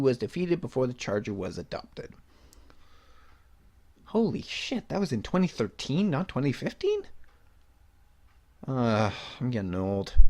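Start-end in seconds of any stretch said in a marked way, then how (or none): 4.1-7.0 pitched way up in disbelief; real shock at how long ago; surprised. Real, not acted. Vlogger realizes the year, shocked and high.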